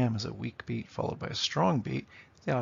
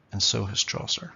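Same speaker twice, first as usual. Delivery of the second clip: ordinary talk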